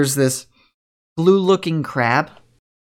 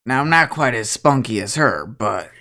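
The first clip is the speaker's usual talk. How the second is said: put-on lower, easygoing older guy; laid-back cowboy; 5 semitones lower than usual. Mitchell greeting the party, lower and laid-back. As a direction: drop it down, relaxed and modest. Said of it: "and he goes".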